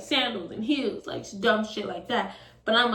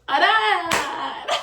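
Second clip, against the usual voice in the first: high, loud mock whine; cringy protest; 9 semitones higher, 6 dB louder than usual. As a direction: drag out a hammed-up refusal. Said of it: "That's how cringe I really was".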